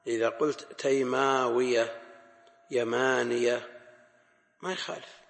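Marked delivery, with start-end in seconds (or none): 0.8-3.8 slow "تيماوية", "يمانية" with stretched "maa"; "تيماوية" and "يمانية" with long alef, undoubled ya; long alef, light ya. Shown as a demonstration. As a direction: draw out the alef, keep the final ya single in "يمانية". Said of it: "لكن إذا عوض عنها".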